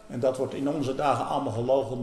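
ordinary talk

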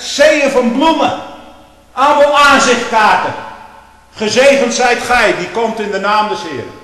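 loud, high pulpit tirade; preaching at full force. Acted. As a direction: hammer each phrase at the congregation. Preacher mocking the praise received.

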